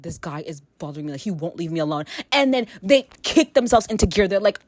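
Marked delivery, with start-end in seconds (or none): 0.0-2.1 much softer, lower confiding plea; quiet plea. Retold or imitated. Woman at a club asking another girl for help, much softer. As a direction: murmur it so the guy can't hear. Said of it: "I'm just like".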